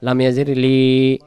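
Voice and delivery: ordinary talk, nothing marked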